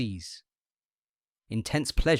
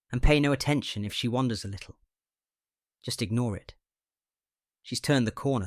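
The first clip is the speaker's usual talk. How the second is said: ordinary delivery, nothing marked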